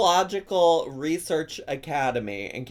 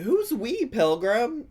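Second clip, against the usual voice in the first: mock sassy challenge; 9 semitones higher, slower than usual. Mock challenge, pitched high.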